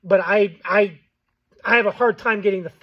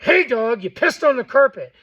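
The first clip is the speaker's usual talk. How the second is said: louder, firm scolding of the dog; 5 semitones higher, 7 dB louder than usual. Shown as a demonstration. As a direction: tell the dog off with real force. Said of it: "You're gonna be like".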